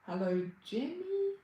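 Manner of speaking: ordinary talk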